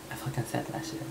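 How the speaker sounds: hushed, voiceless aside to yourself; stunned whisper. Retold or imitated. To himself after first admitting he likes guys, stunned whisper. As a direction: whisper it as if it just slipped out. Stunned at his own admission.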